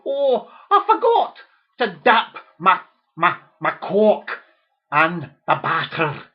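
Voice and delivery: overdone Scottish lament; mock Scottish accent. David Tennant mocked in a Scottish accent. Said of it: "with his Scottish accent".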